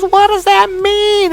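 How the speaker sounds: high, slow, drawn-out exasperated question; heated demand. Retold or imitated. Woman challenging her partner's answer, heated. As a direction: demand it in a mock quarrel yell.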